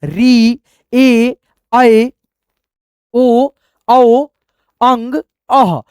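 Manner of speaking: each vowel called out loud and clear, slowing at the end; loud, deliberate vowel drill; reciting the vowels. Shown as a demonstration. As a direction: chant the Hindi vowels like a teacher drilling a class. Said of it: "पहले इसको पढ़िए".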